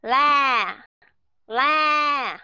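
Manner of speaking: "La" held long and left open, no final consonant; open-mouthed ending. Shown as a demonstration. Teacher showing students an open mouth, long open ending. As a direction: let "La" ring out loud without closing the lips. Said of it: "mở nó sẽ như thế này nè".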